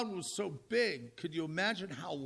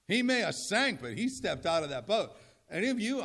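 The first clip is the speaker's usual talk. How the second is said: ordinary delivery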